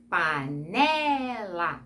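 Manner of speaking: "panela" very slow, with "ne" stretched long; stressed penultimate syllable. Shown as a demonstration. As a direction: draw out the middle of "panela" to show the stress. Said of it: "quando a penúltima sílaba for mais forte". ("panela" bem devagar, alongando a sílaba tônica "ne")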